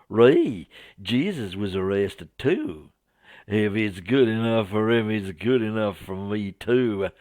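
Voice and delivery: lightly hammed-up Southern American; Southern American accent. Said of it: "my poor attempt at a Southern American accent".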